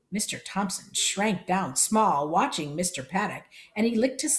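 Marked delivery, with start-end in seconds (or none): none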